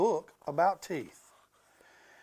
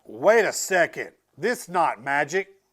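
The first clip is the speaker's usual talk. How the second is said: barked; 8 dB louder than usual. Acted. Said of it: "Ryan barked".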